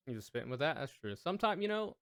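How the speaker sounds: usual speaking voice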